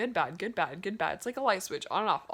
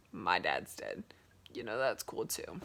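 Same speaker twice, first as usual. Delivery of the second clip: deadpan; 12 semitones lower, 5 dB quieter than usual. Acted. Flat punchline after good news.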